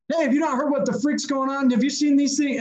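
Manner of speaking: high-pitched, worked-up retort; agitated, insistent mock outburst; mock yelling back. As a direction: imitate yelling back without full volume. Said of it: "So I start yelling at him back".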